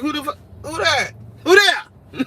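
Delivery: high, rising mock alarm; startled retort. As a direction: yell it as if spooked. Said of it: "I was like".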